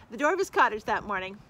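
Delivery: ordinary delivery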